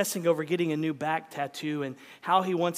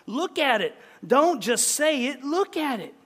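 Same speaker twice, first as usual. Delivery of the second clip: higher, urgent acted plea; crying out; 7 semitones higher, 5 dB louder than usual. A narrator's reading. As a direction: beg her insistently, voice raised. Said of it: "He wheeled around and cried".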